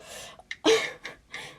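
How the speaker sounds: unvoiced giggle breaking character; breathy laugh. Real, not acted. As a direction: crack up mid-line.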